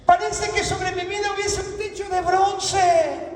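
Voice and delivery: high, shouted lament from the pulpit; impassioned preaching. Acted. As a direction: voice a believer's complaint at full preaching force.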